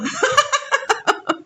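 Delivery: a high, embarrassed burst of laughter. Real, not acted. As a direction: laugh at yourself, caught out. Laughs at own confession. (tawa tinggi malu-malu)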